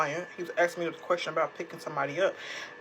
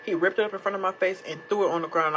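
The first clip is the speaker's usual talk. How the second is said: usual speaking voice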